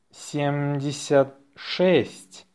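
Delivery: slow dictation, words set apart. Shown as a demonstration. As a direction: say it slowly for learners. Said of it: "say it slowly".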